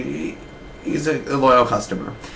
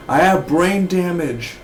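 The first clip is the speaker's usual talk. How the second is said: slow, higher, spaced-out confession; dazed Gordon; 6 semitones higher, slower than usual. Retold or imitated. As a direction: say it like he barely knows where he is. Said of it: "he's like".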